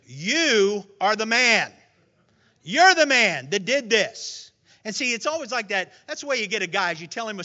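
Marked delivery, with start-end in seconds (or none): 0.1-4.5 slow, loud, weight on You; solemn accusation. Retold or imitated. Prophet Nathan confronting King David, slow and loud. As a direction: lay it on him slowly. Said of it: "Nathan says to him".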